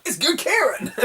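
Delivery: high, laughing recognition; high and laughing, recognising a friend. Retold or imitated. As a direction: laugh out his name in delight. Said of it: "I'm like".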